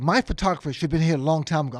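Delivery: loud, quick mock complaint; acted host griping about lateness; Nelson Mandela. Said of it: "He said".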